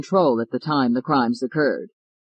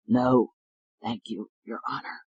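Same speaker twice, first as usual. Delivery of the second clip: hoarse and much softer; 4 semitones lower, 6 dB quieter than usual. Acted. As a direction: rasp it out, sick and beaten. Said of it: "He said in a hoarse voice".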